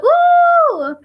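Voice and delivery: high squealing ooh; sudden high-pitched ooh; excited ooh. Retold or imitated. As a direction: squeal with delight at the food. Excited exclamation at food.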